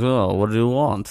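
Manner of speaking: a lightly voiced tough Russian-mobster driver, cold and unimpressed; gruff Viggo mobster. Viggo's driver brushing off the nosy midwife, lightly voiced cold mobster. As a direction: toss it off like an unbothered tough guy. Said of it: "looks and says".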